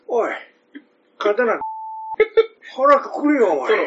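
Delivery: 高く作った殿の物真似; 間を空けて短く言い切る. Acted. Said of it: "殿、殿でしょ、殿。". (芝居がかった殿の声)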